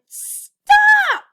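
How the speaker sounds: very high, loud shout. A narrator's reading. Elf shouting at lazy friends, very loud. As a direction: bellow STOP. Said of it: "put her hands up and shouted".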